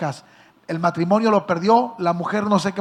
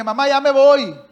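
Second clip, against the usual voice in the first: loud call, louder than the talk around it; calling out goodbye; 5 semitones higher, 6 dB louder than usual. Retold or imitated. Son announcing he's leaving, loud call. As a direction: shout it toward the kitchen.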